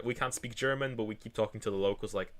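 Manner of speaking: ordinary delivery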